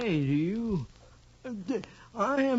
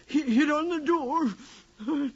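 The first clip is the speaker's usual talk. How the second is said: higher, louder, faster and frantic as he pictures it; panicked rush; 6 semitones higher, 5 dB louder than usual. Acted. Atzerodt pictures the shooting, rising into frantic panic. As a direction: let the panic run away with him.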